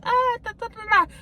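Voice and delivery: high mock babble at a kid being teased; imitating the kid's taunt. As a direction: play the giggling kid, high and silly. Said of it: "Lil J starts laughing at him".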